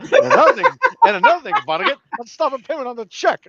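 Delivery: high, loud comic ranting yell; mock cursing rant. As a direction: blow up like a cheated customer. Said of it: "And he's cursing out".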